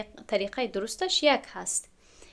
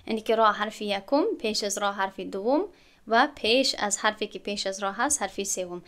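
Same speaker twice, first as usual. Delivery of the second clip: ordinary talk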